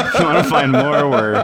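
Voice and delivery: high, loud, laughing through words. Real, not acted. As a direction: try to read the outro while cracking up.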